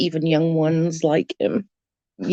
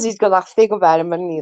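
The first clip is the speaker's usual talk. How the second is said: usual speaking voice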